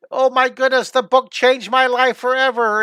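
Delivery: loud, high, hammed-up rave; gushing review. Teacher voicing a sample book review for a viewer, gushing. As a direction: gush like an overjoyed reviewer. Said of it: "and say".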